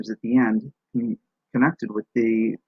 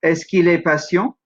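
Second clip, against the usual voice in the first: "Est-ce qu'il est patient?" louder, going up at the end; French question, "Est-ce qu'il est patient?" climbing to the last syllable; rising question tone; 5 semitones higher, 5 dB louder than usual. Shown as a demonstration. Teacher expanding the French question, "Est-ce qu'il est patient?" rising. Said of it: "maybe we expand the question".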